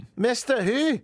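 high, puzzled Scottish query; put-on Scottish accent. Customer querying the shop name, put-on Scottish accent. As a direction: query it like a baffled Scot. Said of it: "Scotland's good".

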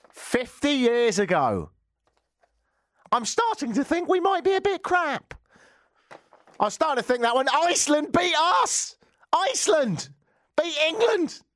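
hammed-up anguished England fan; mock despair. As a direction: wail it like a heartbroken fan. Mock despair about England football.

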